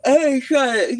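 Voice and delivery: ordinary talk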